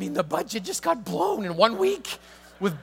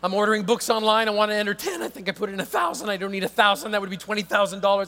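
mock-frantic plea to the agent; pleading caller; 3 semitones higher, 6 dB louder than usual. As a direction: beg the agent for help fast.

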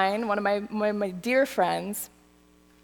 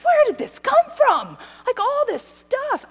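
bewildered; 5 dB louder than usual. Retold or imitated. Retold distress at rough week.

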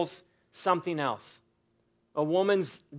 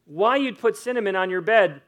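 ordinary delivery

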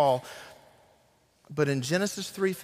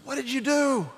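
high, sharp, demanding question; a stunned voice of God asking what he has done; God's shocked question; 11 semitones higher than usual. Acted. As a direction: call out to Adam as God, pitched way up. God confronting Adam after the fall.

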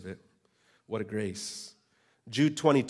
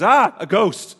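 a higher, louder startled cry of "Ah, a ghost"; hammed-up frightened yelp; mock fright; 7 semitones higher, 9 dB louder, slower than usual. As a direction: play the spooked disciples, hammed up. Disciples react to Jesus appearing.

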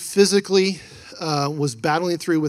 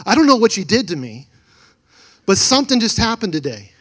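upset caller; 5 semitones higher, 6 dB louder than usual. Retold or imitated. Retold woman freaking out on phone.

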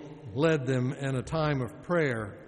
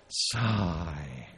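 sighed word; 8 semitones lower than usual. Acted. Demonstrating the communal sigh.

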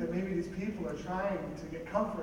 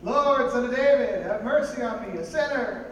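drawn-out call; 4 semitones higher, 8 dB louder than usual. Acted. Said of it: "Why are they talking so slow?".

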